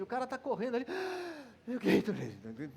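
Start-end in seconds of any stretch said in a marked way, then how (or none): 0.9-1.4 sharp gasp for air like a spent runner; exhausted gasp. Acted. Acting out a runner after the race, a breathless gasp. 1.7-2.7 slow, lower, winded plea; out of breath. Acted. A worn-out runner begging for a drink, panting and slow.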